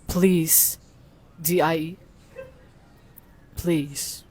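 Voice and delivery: low, hushed AI voice; soft, deep machine delivering a verdict; Google Gemini voice. Acted. Reading out the chatbot's threat, Google Gemini voice. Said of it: "Maghimo ka Google Gemini voice".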